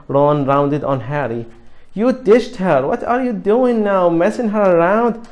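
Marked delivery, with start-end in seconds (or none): 1.9-5.3 raised, high, louder accusation; angry. Acted. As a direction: confront him, voice raised. Ron angrily confronting Harry.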